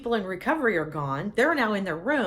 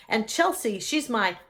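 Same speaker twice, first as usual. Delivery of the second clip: usual speaking voice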